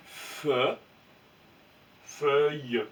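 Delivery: fe-, puis feuille, détachés; découper en syllabes. Shown as a demonstration. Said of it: "ça fait". (fe- puis feuille, séparés)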